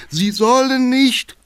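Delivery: high, loud, drawn-out protest; shouting from behind. Acted. Councillor in the back shouting at the cabman. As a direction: cry out in alarm. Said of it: "klang von hinten die Stimme".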